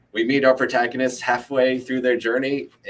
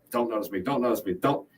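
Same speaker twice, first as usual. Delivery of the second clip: softer, quick repeated pleading; hushed plea; 5 dB quieter than usual. Acted. John hoping the gunman overlooks him, hushed plea. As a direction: plead under your breath. Frightened bystander's silent plea under gunfire.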